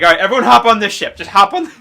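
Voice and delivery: urgent command. Retold or imitated. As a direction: hurry everyone across. Crew ordering everyone onto boat.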